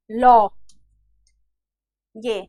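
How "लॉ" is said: लॉ with an open aw vowel, louder; लॉ, neither la nor lo; correct pronunciation. Shown as a demonstration. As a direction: say लॉ with the rounded half-moon vowel. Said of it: "बल्कि सही उच्चारण क्या है?".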